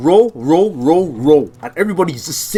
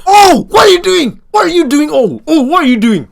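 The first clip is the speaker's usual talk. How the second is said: high, loud repeated demand; alarmed scolding; 11 semitones higher, 8 dB louder than usual. Retold or imitated. Student to friend who finished early, loud alarmed scolding. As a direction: call him out in high-pitched alarm.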